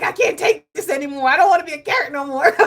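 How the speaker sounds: high, loud, fast exasperated carrot voice; hammed-up fed-up vegetable; voicing the fed-up carrot. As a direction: play the carrot at its breaking point, laughing. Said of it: "The carrot is like".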